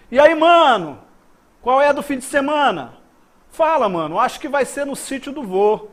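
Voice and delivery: loud, high-pitched acted exchange between mates; casual buddy chat. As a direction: play both friends in a slangy WhatsApp chat. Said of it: "disse bem assim". (conversa animada entre parças, em voz alta)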